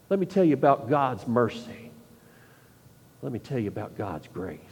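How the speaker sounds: sit beside them and speak gently; gentle and caring. Pretend. Believer comforting his former enemy, soft and patient.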